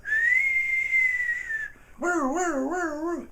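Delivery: high wailing siren sound; siren imitation. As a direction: wail like a police siren. Imitating approaching police sirens.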